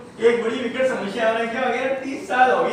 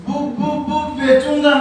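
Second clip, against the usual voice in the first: put-on stuttering boy; 4 semitones higher, 3 dB louder than usual. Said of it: "तुतला के बोलता है".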